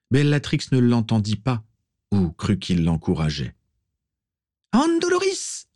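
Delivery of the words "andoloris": very high shrieked spell; roared curse. A narrator's reading. Bellatrix curses her victim with relish, high shriek. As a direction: screech the incantation, pitch soaring. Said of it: "rugit-elle à nouveau".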